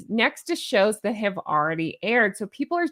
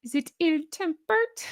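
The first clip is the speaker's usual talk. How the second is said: high, rising movie-villain question; Doctor Evil; 7 semitones higher, 3 dB quieter than usual. Retold or imitated. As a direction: quote a campy villain, pitched up at the end. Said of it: "How about Doctor Evil?".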